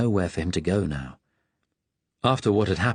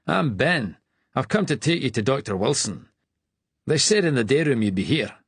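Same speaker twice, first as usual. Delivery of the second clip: Scottish brogue, friendly query; broad Scottish accent; 6 semitones higher than usual. Acted. Attendant greeting a patient, broad Scottish accent. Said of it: "in a broad Scottish accent".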